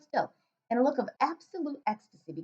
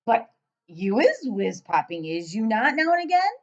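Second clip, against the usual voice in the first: loud giant voice; 7 dB louder than usual. Acted. Giant questioning the girl, booming and puzzled. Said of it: "asked the B F G".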